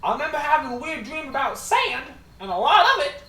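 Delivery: high, loud recounting of a strange dream; high puzzled father. Acted. Father tells the party about a strange dream, high and loud. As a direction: marvel at the weird dream. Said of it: "He looks at you and goes".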